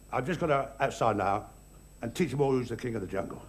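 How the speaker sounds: low, quiet grumbling to himself; grumpy lion. Acted. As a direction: mutter a bad-tempered resolve under your breath. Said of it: "he had a very bad temper".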